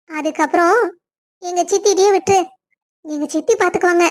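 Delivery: normal talking voice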